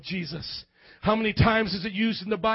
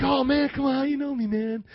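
incredulous churchgoer; 3 semitones higher than usual. Pretend. Imagined newcomer answering the pastor who spotted him, mildly incredulous. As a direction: lightly mimic a disbelieving churchgoer.

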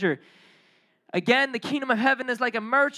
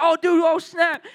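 loud, high-pitched mock freak-out; frantic comic outburst; acted panic; 7 semitones higher, 6 dB louder than usual. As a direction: blurt it like a kid who's been caught. Kids panicking as mom rips cord.

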